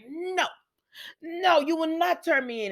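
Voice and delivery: high-pitched imitated protest; mock indignant refusal. Sheila refuses to let Deacon turn her in, mock indignant refusal.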